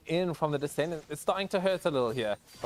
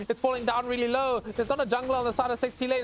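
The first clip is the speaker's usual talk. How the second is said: fast, higher live play-by-play; esports caster; 8 semitones higher than usual. Real, not acted. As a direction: rattle off the fight as it happens. Fast play-by-play of team fight.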